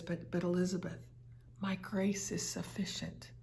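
a soft hushed whisper to Elizabeth; whispered words from God. Retold or imitated. God whispering comfort into the preacher's heart, hushed whisper. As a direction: breathe it like a secret. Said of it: "these words are whispered into my heart".

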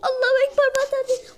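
high loud battle cry; excited shout. Real, not acted. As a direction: yell it out in excitement.

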